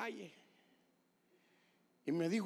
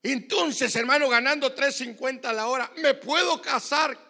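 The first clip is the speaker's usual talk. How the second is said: high, loud, exultant pulpit shout; loud, rousing preacher's delivery; full-force preaching; 8 semitones higher, 11 dB louder than usual. Real, not acted. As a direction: shout the testimony to the congregation.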